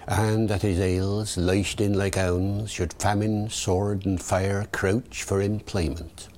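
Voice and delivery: original pronunciation, heels as hails; Early Modern English verse accent; Shakespearean original pronunciation. Shown as a demonstration. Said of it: "David the O P".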